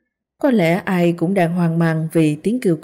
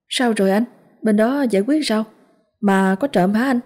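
concerned neighbour; 4 semitones higher than usual. Acted. Neighbour asks about the incident.

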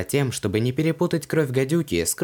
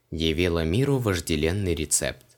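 ordinary delivery, nothing marked

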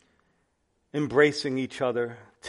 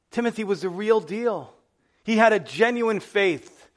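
higher, louder preaching burst; preaching at full force; 6 semitones higher, 4 dB louder than usual. Acted. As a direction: drive it home from the pulpit.

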